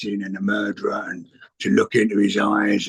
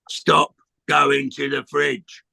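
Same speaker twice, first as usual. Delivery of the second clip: slow punchline; much slower than usual. Real, not acted. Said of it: "slow punchline".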